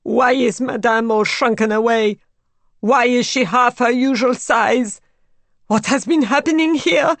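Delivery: harsh and shaking. A narrator's reading. Maid Elise questioning Raoul over the body, voice shaking harshly. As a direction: shake with rising hysteria. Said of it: "harsh and shaking".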